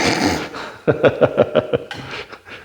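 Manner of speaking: real rapid, wheezy chuckle. Real, not acted. Officer finishing his story of backup arriving late, chuckling. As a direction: let out a run of voiceless chuckles.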